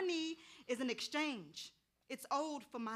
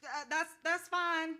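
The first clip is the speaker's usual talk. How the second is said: flustered; 6 semitones higher, 11 dB louder, slower than usual. Acted. Flustered reply to client.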